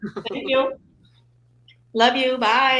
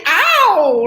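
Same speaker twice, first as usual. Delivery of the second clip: mock ow; 6 semitones higher, 10 dB louder than usual. Shown as a demonstration. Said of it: "I might be like".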